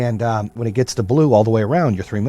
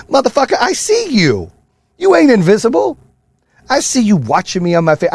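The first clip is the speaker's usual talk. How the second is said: high, loud taunting callout; pointed taunt at the hidden watchers; calling out haters; 11 semitones higher, 4 dB louder than usual. Real, not acted. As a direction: call them out, daring them.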